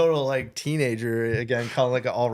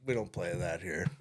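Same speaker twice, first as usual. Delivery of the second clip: lower, much softer deadpan refusal; mock deadpan host; 7 semitones lower, 11 dB quieter than usual. Pretend station host refusing the request, mock deadpan. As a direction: drop it flat and dry.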